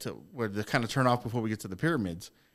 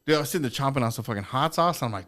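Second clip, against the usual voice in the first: ordinary delivery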